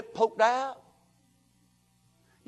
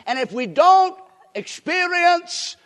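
higher, louder and slow; preacher closing with force; rising sermon climax; 6 semitones higher, 8 dB louder, slower than usual. Real, not acted. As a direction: stretch it out to a climax.

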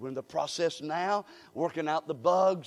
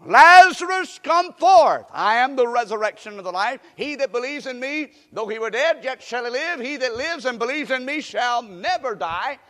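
loud, high commanding call into the tomb; 5 semitones higher, 10 dB louder than usual. Acted. Jesus calling Lazarus out of the tomb, commanding shout. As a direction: call the dead man out.

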